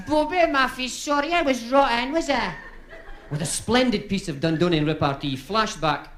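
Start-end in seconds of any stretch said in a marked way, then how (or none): 0.1-2.5 high falsetto wifey. Acted. Wife voice in joke.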